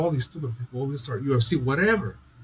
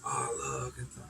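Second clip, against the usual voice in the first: a much lower, softer quoted voice; mock low murmur; 11 semitones lower, 13 dB quieter than usual. Imitating people back then in a low murmur. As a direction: drop low and soft to imitate them. Quoting someone, voice drops.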